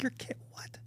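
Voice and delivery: stunned, breathless whisper; shocked whisper. Retold or imitated. Hearing the friend's confession, shocked whisper. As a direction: whisper it in disbelief. Friend fakes fights to avoid sex.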